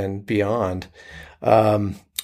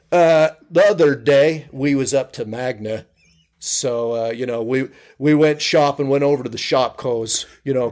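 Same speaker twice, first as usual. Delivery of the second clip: Southern Utah County accent; 2 semitones higher than usual. Shown as a demonstration. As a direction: play a Spanish Fork local chatting. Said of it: "you may hear a conversation like this".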